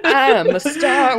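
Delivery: slow, high, laughing brag; hammed-up star, laughing; diva boast. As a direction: preen like a diva.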